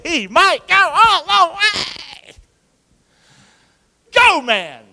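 slow, high, loud play-call; sportscaster excitement. Acted. Preacher calling a football breakaway, high and slow. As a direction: stretch it out like a big run call.

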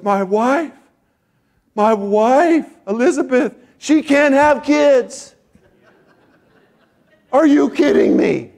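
high, loud disbelief, stretching the words; incredulous shout. Acted. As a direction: exclaim in utter disbelief as a stunned husband. Zechariah's stunned reply, acted out.

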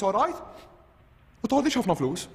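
ordinary talk

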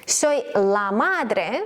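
very slow, stretched-out Spanish phrase; slow teaching delivery. Shown as a demonstration. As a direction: draw out each word for learners. Slow model sentence for learners.

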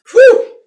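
a high, loud whoosh of breath as the dip burns; sharp high whew. Real, not acted. Reacting to the strong dip to viewers, sharp high whew. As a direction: blow it out high, like the burn just hit.

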